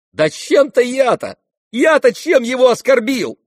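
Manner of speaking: high, loud, mocking protest; mocking insistence. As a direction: press the question with a jeer. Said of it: "как будто тем же насмешливым тоном".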